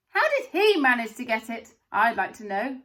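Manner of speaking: loud, resentful shout; angry shout. A narrator's reading. A large boy in the crowd shouts angrily about Charlie. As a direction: shout it out, jealous and cross. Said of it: "a large boy shouted angrily".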